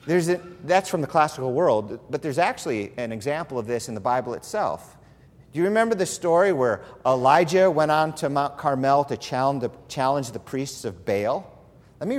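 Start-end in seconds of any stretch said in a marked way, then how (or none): none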